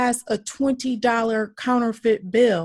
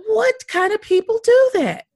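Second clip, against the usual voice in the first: higher and louder, indignant question; outraged; 13 semitones higher, 7 dB louder than usual. Real, not acted. Appalled at the families who made lynching postcards, outraged. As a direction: demand an answer in disbelief.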